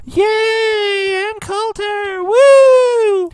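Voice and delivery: very high, squealed mock fangirl cheer; over-the-top "yay" and "woo".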